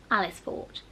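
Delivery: usual speaking voice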